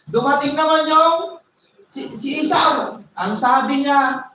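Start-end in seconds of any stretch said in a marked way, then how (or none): none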